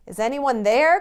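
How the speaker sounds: lightly voiced regal call. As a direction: call out grandly. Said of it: "called a regal-sounding voice".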